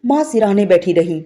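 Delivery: plain reading voice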